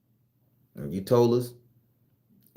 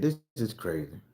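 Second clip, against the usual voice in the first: softer each time, sinking low; quiet stunned repeat; fading disbelief; 7 semitones lower, 11 dB quieter than usual. Real, not acted. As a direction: let it die away in disbelief. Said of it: "I said".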